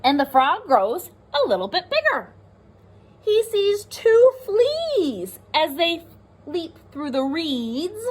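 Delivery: put-on lively read-aloud for young children; teacher reading a rhyming book to the class; storybook reading. A narrator's reading.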